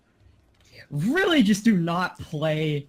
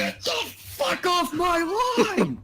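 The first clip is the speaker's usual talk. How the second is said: shouting; slower than usual. Acted. God shouting at the caller.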